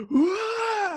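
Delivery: high drawn-out "waah"; mock battle cry. Friend re-enacting the lightsaber fight, mock battle cry. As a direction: wail it high like a clumsy attacker. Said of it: "He was fighting Casey and he goes".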